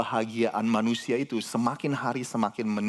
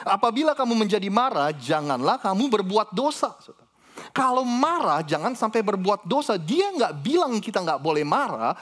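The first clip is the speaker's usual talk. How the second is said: preaching at full force; 8 semitones higher than usual. Acted. Pastor preaching the verse to the congregation at full force. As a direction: drive the verse home to the church.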